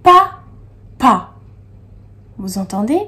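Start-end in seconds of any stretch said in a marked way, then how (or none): 0.0-1.3 papa as two loud, high, separated syllables. Shown as a demonstration. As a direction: say pa, long pause, pa for papa. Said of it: "écoutez bien quand je dis".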